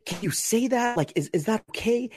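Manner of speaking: high, rushed mock panic; high anxious voice, half laughing; panicked. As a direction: fret like you might get possessed. Panicking about a demon.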